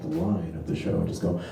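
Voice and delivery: usual speaking voice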